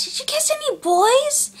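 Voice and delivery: high, singsong prying question; exaggerated high-pitched parental prying; mock nosy mom. Voices mom on the phone.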